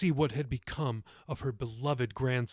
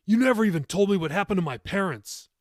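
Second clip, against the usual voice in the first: loud, higher, hurt accusation; angry accusation; 6 semitones higher, 6 dB louder than usual. A narrator's reading. Grandson accusing grandmother about his parents, angry and loud. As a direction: hurl the blame at her.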